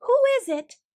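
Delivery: high, loud startled call into the dark; loud, high startled cry. Acted. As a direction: shout it out, jumping at the sight. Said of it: "cried Louis".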